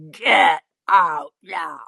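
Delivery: slow, low-pitched threat; stretched, ominous command; menacing. Acted. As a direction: imitate a looming man, drawing out each word. Man orders her off, leaning closer.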